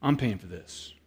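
low, quiet indignation; indignant. Retold or imitated. Customer pushing the free food away, low indignant refusal. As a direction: refuse the gift in a low, firm voice.

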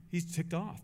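ordinary delivery

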